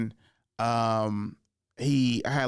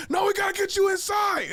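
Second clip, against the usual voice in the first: high-pitched shouted imitation of friend; insistent yell; 4 dB louder than usual. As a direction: overrule him loudly, voice way up.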